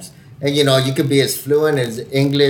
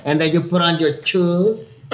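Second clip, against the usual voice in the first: put-on slower, higher Mexican accent, SHOES said "chooz"; 4 semitones higher than usual. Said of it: "I got so Mexican right there".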